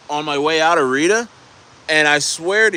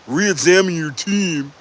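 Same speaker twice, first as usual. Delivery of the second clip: mock critic; 3 semitones higher, slower than usual. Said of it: "here's a guy that's like".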